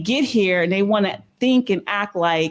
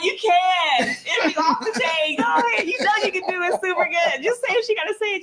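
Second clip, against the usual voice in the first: eager urging; 8 semitones higher than usual. Real, not acted. Urging friend to do accent.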